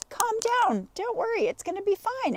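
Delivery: high-pitched imitation of a soothing partner; exaggerated high comforting voice; mock reassurance. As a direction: imitate someone nagging you to calm down. Mimics boyfriend's repeated soothing.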